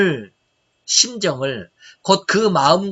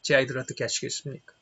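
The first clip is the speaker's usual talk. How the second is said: much softer, pressing plea to God; hushed pleading prayer; 4 semitones lower, 9 dB quieter than usual. Retold or imitated. Father with cancer pleading with God for his children, hushed. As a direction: pray it low and urgent, almost to yourself. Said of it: "늘 기도했습니다".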